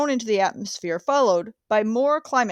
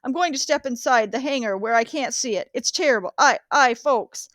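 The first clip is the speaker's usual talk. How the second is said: horrified reporting; 3 semitones higher than usual. Acted. Reporter watching the airship burn before the crowd, horrified and pleading. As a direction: cry out the disaster as it unfolds. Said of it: "describing the crash of the Hindenburg".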